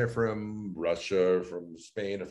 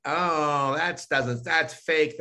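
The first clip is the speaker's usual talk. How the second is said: higher, dismissive imitation of a critic; mock disapproval; 6 semitones higher, much slower than usual. People judging someone's new voice, mock disapproval. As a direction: scoff lightly as a doubter would. Voicing people who judge the changed voice.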